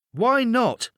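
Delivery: a kid caught out, playing it cool; child voice. A narrator's reading. Boy hiding under covers answers his mum, high child voice.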